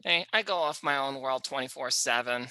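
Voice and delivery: normal talking voice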